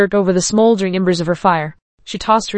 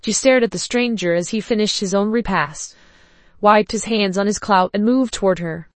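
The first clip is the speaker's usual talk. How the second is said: plain reading voice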